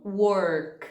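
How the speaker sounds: "work" with a curled retroflex R from Minas Gerais. Shown as a demonstration. Teacher imitating a mineiro saying "work", retroflex R. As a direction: roll "work" with a country Minas R. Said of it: "o R de Minas costuma ser esse er".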